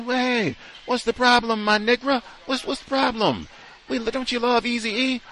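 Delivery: very high, put-on sing-out voice; mock chummy visitor. Visitor trying to bond over rap music, high mock-chummy. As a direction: squeal it like an over-familiar fan, mockingly.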